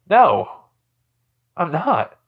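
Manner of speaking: high, loud defiant no; defiant refusal. Acted. Boy refusing to apologise for hitting, defiant.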